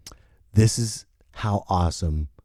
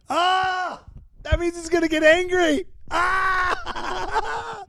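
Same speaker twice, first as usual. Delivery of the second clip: enraged roar; slower than usual. Acted. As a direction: explode in a hammed-up rage yell. Rambo losing his temper.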